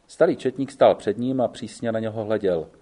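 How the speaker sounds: usual speaking voice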